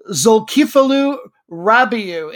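slow, syllable by syllable: "Zulkiflu Rabiu" as zul-KEE-floo ra-BEE-oo; careful phonetic reading. Shown as a demonstration. Host reading a player's name for listeners, slow and careful. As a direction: sound out "Zulkiflu Rabiu" slowly, every syllable given its full weight. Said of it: "I'm going with a phonetic pronunciation".

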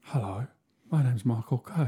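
soft, low Cockney impression; Michael Caine. Host introducing himself as the actor, hushed impression. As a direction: quietly slip into a bad London film-star voice. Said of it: "Stop doing your Michael Caine impression".

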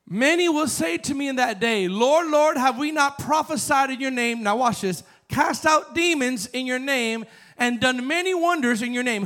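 high, loud preaching read; preacher reading scripture aloud; fervent scripture reading. Acted. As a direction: proclaim the verse with fire. Reads scripture with fervor.

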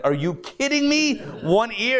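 an officer who can't believe what he heard; incredulous. Retold or imitated. Officer disbelieving recruit's answer.

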